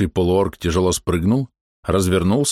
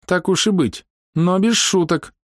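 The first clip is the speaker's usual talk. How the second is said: high, reluctant female voice; displeased high-pitched consent; grudging agreement; 10 semitones higher, slower than usual. A narrator's reading. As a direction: concede unwillingly, high and drawn out. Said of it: "берёза недовольно согласилась".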